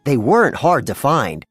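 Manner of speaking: plain reading voice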